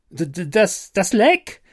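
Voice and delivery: stammering the first word, breaking off; stuttering. A narrator's reading. Exhausted and shaken, stammering to Bernard about the leak. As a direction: stumble over the words, shaken. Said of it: "stottere ich".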